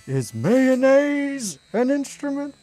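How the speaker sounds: high, loud, slow dopey question; Patrick voice. Retold or imitated. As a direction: ask it like a clueless cartoon starfish. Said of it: "Do quote Band Geeks".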